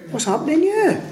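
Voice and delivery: high-pitched mock outrage; acted indignation. Fellow servants protesting unfair treatment, indignant disbelief. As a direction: question it in disbelief, pitch shooting up.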